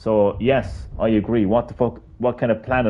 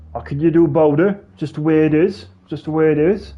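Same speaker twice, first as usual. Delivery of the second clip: higher mimicry of people giving up; higher, louder mocking shrug; mock resigned shrug; 6 semitones higher, 4 dB louder than usual. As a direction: parody the "nothing to be done" crowd. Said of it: "that's people's reaction now".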